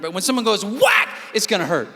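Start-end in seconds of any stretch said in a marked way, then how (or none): 0.6-1.1 sharp high "Whack!" sound effect; mock whack. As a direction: bark out the hit like a stick striking. Imitated stick hit.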